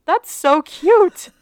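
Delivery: high slow squeal of delight. Real, not acted. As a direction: gush over something adorable. Delighted at fairy umbrellas.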